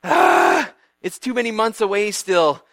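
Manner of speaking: scheming Haman relishing the kill; hammed-up villainous yearning; gloating villain. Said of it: "And he's just like".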